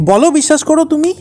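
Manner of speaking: loud, high, insistent; angry demand. Acted. Husband presses hesitant wife for an answer, angrily.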